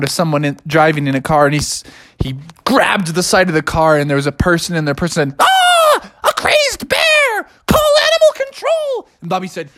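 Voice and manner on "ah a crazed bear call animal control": very high hammed-up scream; terrified shriek. Driver seeing a bear grab the car, shrieking. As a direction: shriek in cartoon panic.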